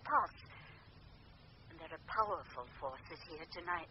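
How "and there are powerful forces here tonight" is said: ominous hush. Acted. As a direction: lower and much softer, a grave warning. Medium warns of spirits.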